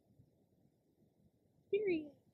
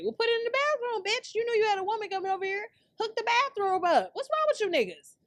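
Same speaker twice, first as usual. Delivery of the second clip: high, loud comic tirade; raised, indignant telling-off; exasperated scolding; 13 semitones higher, 5 dB louder than usual. Pretend. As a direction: rant as if telling off a hopeless host. Scolds absent host about the bathroom.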